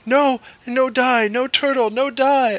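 put-on high, slow little-kid plea; toddler voice. Son upset at a dying turtle, high slow child plea. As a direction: plead like an upset small child. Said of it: "He goes".

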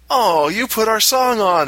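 high, slow delighted gasp of realization; wife realizes husband arranged their song, high and delighted; touched realization. Retold or imitated. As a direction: melt as it dawns on her. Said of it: "she realizes".

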